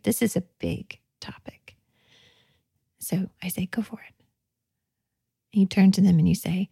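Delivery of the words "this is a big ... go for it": whisper. Real, not acted. Hushed aside while guiding group.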